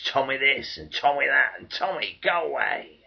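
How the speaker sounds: loud jeering dismissal; scornful call. Acted. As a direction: fling it out like a sneering crowd. Scornful civilians dismissing the soldier.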